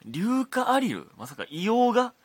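loud, high-pitched disbelief; astonished questioning. Real, not acted. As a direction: draw it out in amazed recognition. Astonished by the listener's chemistry finding. (驚いて声が裏返り気味に)

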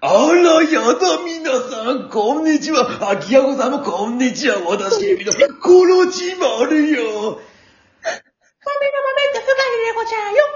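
high, sing-song campy lady. Acted. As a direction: flounce in as a camp diva. Puppet introduces herself.

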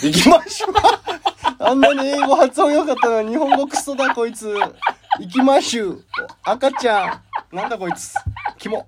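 high-pitched helpless laughing; cracking up while mocking a slip; laughing mockery. Real, not acted. Mocks co-host's slip, laughing.

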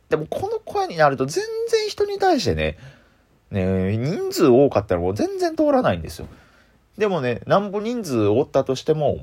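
high-pitched voice that does not carry; high excited voice. Shown as a demonstration. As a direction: let your voice climb up when excited. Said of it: "どんどん高い声になっていっちゃうんです".

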